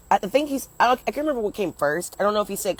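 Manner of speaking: ordinary talk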